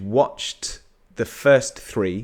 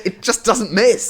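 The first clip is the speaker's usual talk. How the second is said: excited; 11 semitones higher, 6 dB louder than usual. Real, not acted. Excited agreement about the film.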